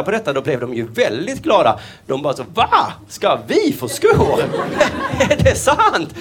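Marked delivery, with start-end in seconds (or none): 2.5-6.1 high, slow, laughing disbelief; thrilled horse. Acted. Horse hearing it gets shoes, high and thrilled. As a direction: gasp with delight at the news.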